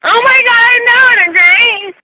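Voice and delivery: loud exasperated outburst. Real, not acted. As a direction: throw up your hands, loudly. Frustrated outburst on bad call.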